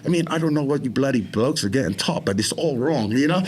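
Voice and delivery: British accent. Shown as a demonstration. Man coaching himself through his therapy, British accent. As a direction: talk to yourself as a British bloke. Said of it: "when I'm playing a different role".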